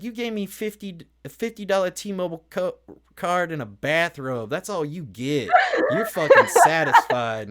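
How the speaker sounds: high put-on voice of an outraged woman; hammed-up imitation of her telling him off; mock indignant scolding. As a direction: scold him, building to a loud high finish. Voices woman angrily refusing him.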